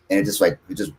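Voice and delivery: normal talking voice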